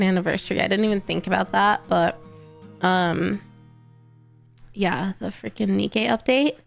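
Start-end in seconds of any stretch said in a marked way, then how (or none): none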